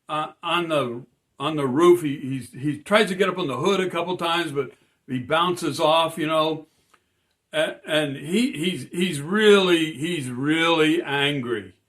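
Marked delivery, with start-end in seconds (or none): none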